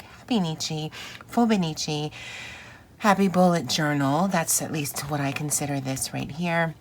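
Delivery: ordinary delivery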